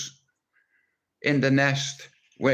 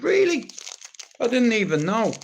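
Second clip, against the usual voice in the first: squeaky high "Really?" then back down; mock surprise; 9 semitones higher than usual. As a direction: play it up like the news is a shock, voice jumping way up. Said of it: "I was like".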